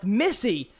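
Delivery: ordinary talk, nothing marked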